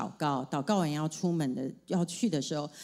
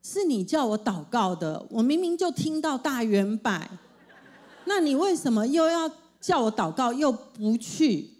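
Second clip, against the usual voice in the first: put-on higher, louder protest of a little girl; complaining daughter; 4 semitones higher, 3 dB louder than usual. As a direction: whine it like a child who feels cheated. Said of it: "一边忍不住又说". (女儿委屈抱怨，声音偏高偏响)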